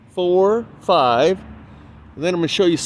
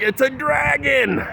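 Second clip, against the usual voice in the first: high, drawn-out mock yell; imitating your own excited shout; retold yell; 8 semitones higher, slower than usual. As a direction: call it out high without real volume. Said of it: "And I yell out".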